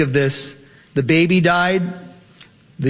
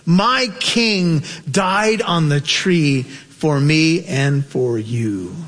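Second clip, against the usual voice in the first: higher, impassioned sermon voice; full-force preaching; 7 semitones higher than usual. Acted. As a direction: preach it out with rising fervour.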